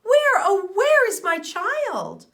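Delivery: frightened mother calling out; alarmed gasp. A narrator's reading. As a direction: cry out for a lost child in alarm.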